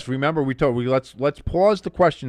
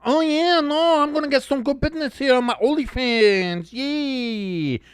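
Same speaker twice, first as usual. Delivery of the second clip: high mock valley-girl gloating; mock her smug cheer; 9 semitones higher than usual. Mocking the Doctor Phil girl, high smug girl voice. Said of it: "The Doctor Phil girl".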